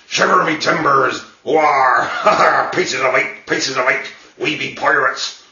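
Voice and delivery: hearty pirate accent with arr-laughs. Shown as a demonstration. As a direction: growl it out like a stage pirate accent. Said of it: "a little bit to the pirate accent".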